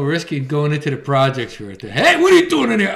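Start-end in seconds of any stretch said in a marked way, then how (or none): 1.8-2.9 high, loud mock confrontation; mock challenge. Acting out being caught in the projects, mock challenge. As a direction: call out like someone catching an intruder.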